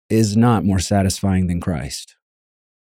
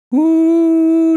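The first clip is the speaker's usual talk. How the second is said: delighted woo; 3 dB louder than usual. Real, not acted. Delighted holler while preaching.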